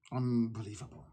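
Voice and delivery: low, soft, under the breath; muttered disbelief. Real, not acted. Activist to listeners, dropping to a disgusted mutter. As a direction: mutter it to yourself, shaking your head.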